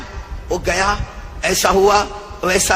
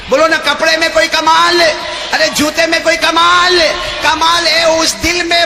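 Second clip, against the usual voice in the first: louder, higher rising sermon call; a preacher drives the gathering toward the creed, loud; preaching at full force; 4 semitones higher, 4 dB louder than usual. Acted. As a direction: build it up for the crowd to answer. Preaching crescendo with crowd.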